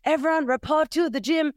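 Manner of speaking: high announcing teacher voice; teacher announcement. Retold or imitated. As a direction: call it out like a school teacher. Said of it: "the teacher's like".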